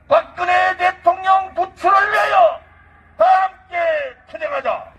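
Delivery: shouted high political rally call; roaring rallying speech. Acted. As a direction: rouse the crowd at full force.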